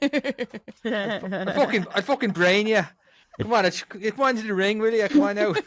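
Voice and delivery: mock-threatening thick Dublin accent; Conor McGregor impression. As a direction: ham up a Dublin fighter goading a rival. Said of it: "his Dublin accent is something else".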